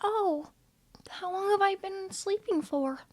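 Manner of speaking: high, soft and shy; a small, unsure voice; timid Cookie. Acted. Cookie asking Shadow how long he slept, high soft timid voice.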